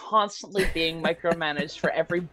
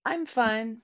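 light, higher-pitched, easy reassurance; honest "I'm fine"; 5 semitones higher, 4 dB quieter than usual. Shown as a demonstration. Showing friends examples of tone, honest "I'm fine". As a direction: say it like you really are fine. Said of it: "like, a, generally, like, an honest".

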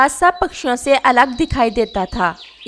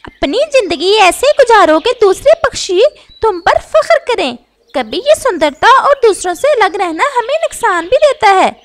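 high bird voice lecturing his son; father parrot cautioning against pride; advising father parrot; 9 semitones higher than usual. Acted. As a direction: gently warn your proud child.

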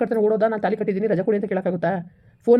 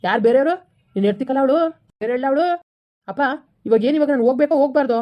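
raised, louder voice, snapping back in a quarrel; angry retort; 4 semitones higher, 3 dB louder than usual. Acted. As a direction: push back hard at the mother, voice up. Heated family quarrel with mother.

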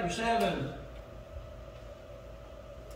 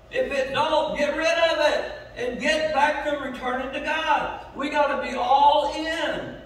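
louder and higher, driving the sermon home; full-force preaching; 4 semitones higher, 4 dB louder than usual. Acted. Pastor rousing his church to revival, preaching at full force. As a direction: preach it hard to the pews.

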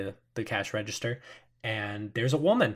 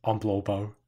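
lightly voiced big man; 6 dB louder than usual. Large club-holding man greets the group, dim. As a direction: greet them dimly. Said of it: "kinda pale-looking man who says".

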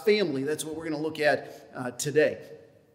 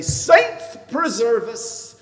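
slow, lilting Irish brogue; Irish accent, drawn out slowly; 6 semitones higher, 8 dB louder, much slower than usual. Shown as a demonstration. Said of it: "When I'm in an Irish mood".

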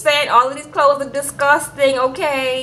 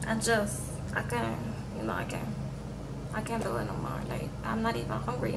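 much softer, drained and defeated; quiet, defeated; 4 semitones lower, 16 dB quieter, faster than usual. Retold or imitated. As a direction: barely get it out, worn down. Bride too upset to eat.

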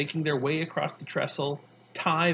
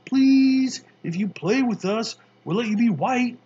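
put-on pleading kids; 8 semitones higher, 8 dB louder than usual. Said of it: "They say".

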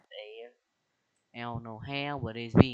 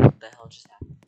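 startled yell; 16 dB louder, faster than usual. Real, not acted. Sudden chaos in game.

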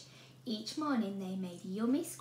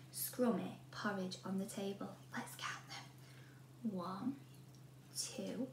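sneaky storytelling whisper; 4 semitones lower, 9 dB quieter than usual. A narrator's reading. Narrator whispering to children as Goldilocks sneaks in. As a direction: hush it as she creeps.

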